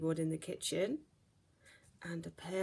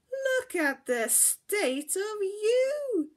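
much higher, louder, slow shocked gasp; gasping mother; 12 semitones higher, 9 dB louder, slower than usual. Acted. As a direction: gasp in dismay at a filthy child. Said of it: "she gasped".

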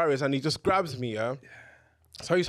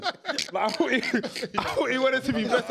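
laughing; 7 semitones higher than usual. Real, not acted. Laughing at own prayer story.

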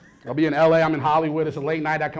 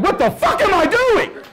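panicked high outburst; 4 dB louder, slower than usual. Retold or imitated.

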